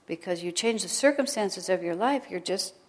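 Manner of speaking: normal talking voice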